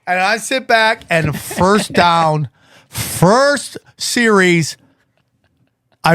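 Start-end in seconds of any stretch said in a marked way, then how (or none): 1.1-4.8 slow dread. Real, not acted. Comic dread buildup.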